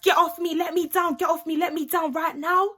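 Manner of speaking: high, loud, insistent demands; retold protesting yell. Girl thrown over a man's shoulder, high protesting yell. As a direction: re-enact a struggle to be put down. Retold struggle while being carried off.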